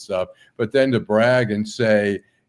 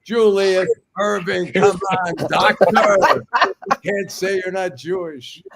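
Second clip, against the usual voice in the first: much higher, loud, hammed-up TV host; skit host voice; 10 semitones higher, 4 dB louder than usual. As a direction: needle the guest like a comedy-sketch host. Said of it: "then they have Doctor Julius Erving".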